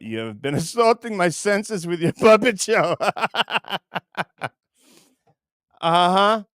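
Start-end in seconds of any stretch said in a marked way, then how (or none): 3.0-4.5 laugh. Real, not acted. Laughs mid-sentence at chat.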